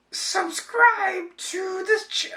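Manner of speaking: very high, slow, strained Toad falsetto, breaking off; retrying the Toad voice, cut off mid-word. Shown as a demonstration. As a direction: stretch the squeak out slowly until it gives up. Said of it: "Let me try it again".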